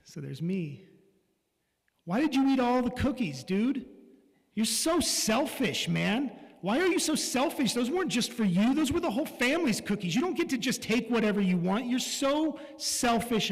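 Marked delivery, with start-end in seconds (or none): 2.0-13.5 loud, high, furious scolding; angry yelling. Retold or imitated. Father scolding his young son over the cookies, shouting angrily. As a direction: tear into the kid, pitch climbing.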